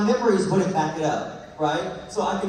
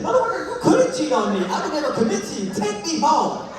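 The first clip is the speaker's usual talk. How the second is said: high, indignant mock rant, laughing through it; a high, laughing mock tirade at a bad date; retold telling-off; 9 semitones higher, 3 dB louder than usual. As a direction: fire it back at the date, half laughing.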